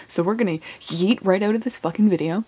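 ordinary delivery

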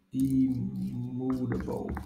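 ordinary talk, nothing marked

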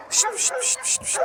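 soft hissed psst calling sounds; hissed psst calls. Real, not acted. Calling the birds over with soft hissed psst. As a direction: hiss psst to lure the birds.

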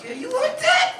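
high hammed-up bedroom taunt; mock eager come-on. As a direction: squeak it out, overexcited. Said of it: "you be like".

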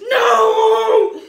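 very loud, high, drawn-out "no"; wailed protest. Retold or imitated. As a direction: cry out in mock despair. Retold reaction to coworker leaving.

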